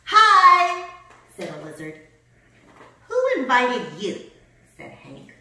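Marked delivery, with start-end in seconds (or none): 0.0-0.9 very loud, high, stretched greeting; calling out. A narrator's reading. Lizard greets the grumpy cactus, calling out. 3.1-4.3 loud, slow, indignant question; grumpy challenge. A narrator's reading. Hank confronts the lizard, grumpy. Cactus snaps at unwanted lizard.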